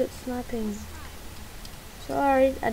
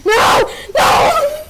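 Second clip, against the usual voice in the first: NO! yelled high and loud, the second even higher; desperate shout; 9 dB louder than usual. Real, not acted. Player losing a fight, shouting in despair. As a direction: cry out as you lose it. Player getting killed in game.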